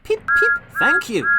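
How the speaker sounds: high, loud whistle-like peep; whistled peep. A narrator's reading. Little engine whistling thanks to waving children. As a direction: toot it out like a cheerful engine. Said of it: "And he whistled".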